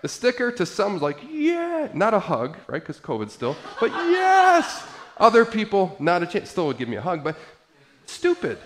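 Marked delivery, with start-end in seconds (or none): 1.3-1.9 quick high cheer; mock cheer. Society cheering the vaccine sticker, high mock cheer. 3.8-4.9 much higher, louder, hammed-up yes; mock triumphant cheer. Society approving the sticker, hammed-up loud high cheer.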